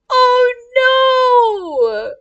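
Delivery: high, drawn-out sympathetic wail; wailing dismay. Real, not acted. Reader pities the crying kid, wailing dismay. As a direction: wail it out, stretching the vowels.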